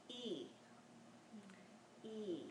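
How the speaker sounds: long E said softly from the palate. Shown as a demonstration. Teacher models the I vowel as a soft long E. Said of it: "the I sound is".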